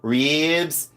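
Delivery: RIBS held long as "reebs"; mock Southern "reeeebs"; imitated Southern drawl. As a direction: mimic a Southern, stretched vowel in RIBS. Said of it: "I love their accent".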